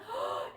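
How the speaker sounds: quick high gasp of pretend amazement; delighted gasp. Teacher gasping over misty paint for the kids. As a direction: gasp as if the paint surprised you. Teacher marvels at mist effect.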